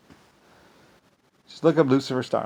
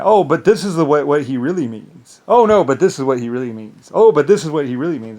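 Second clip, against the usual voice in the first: mocking know-it-alls; 4 semitones higher, 12 dB louder than usual. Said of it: "they try and".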